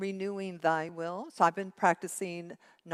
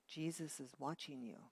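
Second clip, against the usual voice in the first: soft, hushed parrot voice, quieter; 3 semitones lower, 12 dB quieter than usual. A narrator's reading. Parrot warns the burglar in a soft hushed voice. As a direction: hush it like a hidden voice. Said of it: "a little soft voice say".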